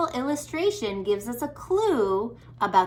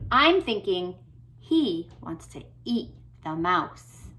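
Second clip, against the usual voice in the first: usual speaking voice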